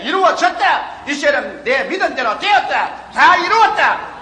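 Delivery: loud, high, drawn-out proclamation; acted-out cry of assured faith; triumphant declaration. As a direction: proclaim it slowly in ringing victory. Acted declaration of answered prayer.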